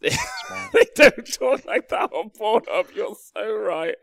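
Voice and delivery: laughing while repeating the joke. Real, not acted. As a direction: burst out laughing through it. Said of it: "laughing repeat of a joke".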